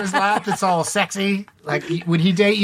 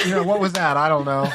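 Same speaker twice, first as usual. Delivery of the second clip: ordinary talk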